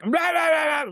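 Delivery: loud, high rah rah imitating the blaring video; mock blaring video noise. Man at the bar mimicking the neighbour's loud Facebook videos, loud mock yell. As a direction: shout it like a phone cranked to full volume. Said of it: "he's just like".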